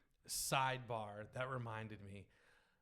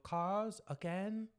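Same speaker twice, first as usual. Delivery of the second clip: put-on toddler voice; 8 semitones higher, much slower than usual. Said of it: "and then go".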